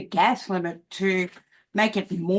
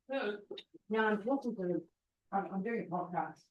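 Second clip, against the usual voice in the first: turned off-mic, distant and much softer; away from the mic; 3 semitones higher, 12 dB quieter than usual. Real, not acted. Vlogger tells someone in the room he's podcasting, distant and soft. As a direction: talk over your shoulder to someone in the room.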